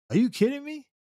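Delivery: high, stunned disbelief; can't believe what he's hearing; are you serious; incredulous. Real, not acted. Disbelief at cop's claims.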